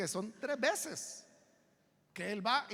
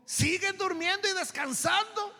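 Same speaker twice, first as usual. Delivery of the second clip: high, loud, drawn-out question; forceful question; 10 semitones higher, 6 dB louder than usual. Acted. Preacher voicing the Lord to sleeping disciples, forceful question. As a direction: raise DURMIENDO Y DESCANSANDO high, ending upward. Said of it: "lo pone en forma de pregunta".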